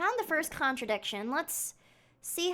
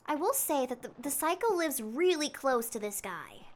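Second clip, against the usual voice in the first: normal talking voice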